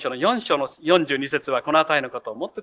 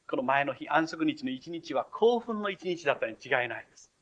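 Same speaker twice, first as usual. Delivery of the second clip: ordinary delivery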